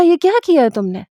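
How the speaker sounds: sudden high, shocked demand; a husband stunned by the slap, crying out; shocked outburst. Retold or imitated. To his wife after she slaps the boy, shocked and high.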